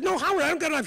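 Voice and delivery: put-on high, insistent little-kid denial; retold childish protest. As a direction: voice a kindergartner insisting, pitched right up. Retells himself as child protesting.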